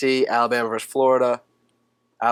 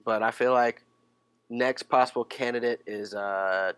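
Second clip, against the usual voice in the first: ordinary talk, nothing marked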